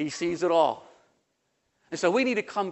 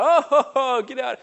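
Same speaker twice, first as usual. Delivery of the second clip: mock laugh; 5 semitones higher, 6 dB louder than usual. Sarah laughing to herself, acted.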